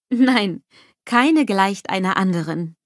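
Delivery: plain reading voice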